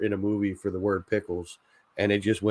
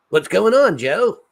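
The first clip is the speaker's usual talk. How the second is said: much higher, louder cartoon greeting; cartoon impression; 11 semitones higher, 7 dB louder than usual. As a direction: yelp it like a cartoon neighbor. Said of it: "my impression of him".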